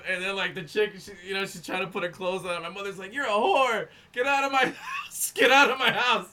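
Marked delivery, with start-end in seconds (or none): 3.1-6.3 loud, high retold yelling; imitated angry mother. Mother throwing the girl out, mock furious shouting. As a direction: act out a mom yelling someone out.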